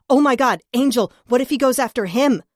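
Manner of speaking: high, loud panicked outburst; panicked cry. Acted. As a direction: blurt it out in sudden alarm. Sudden panic for Angel.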